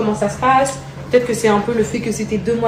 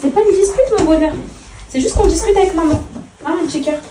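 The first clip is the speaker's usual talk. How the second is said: high, gentle reassuring coo; mother reassuring her upset son; soothing endearments; 9 semitones higher than usual. Real, not acted. Soothing child, not a dispute.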